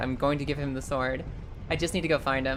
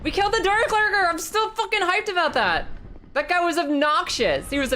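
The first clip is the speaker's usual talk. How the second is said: excited; 12 semitones higher than usual. Real, not acted. Celebrates beating the Dark Lurker.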